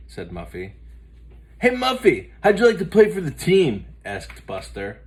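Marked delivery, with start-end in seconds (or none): 1.6-3.9 high, loud, fast eager ask; an excited boy; eager invitation. Acted.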